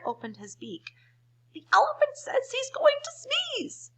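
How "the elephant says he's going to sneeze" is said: very high, louder squawk; a parrot shrieking an alarm. Acted. The parrot warns everyone, shrieking high. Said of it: "With a shriek".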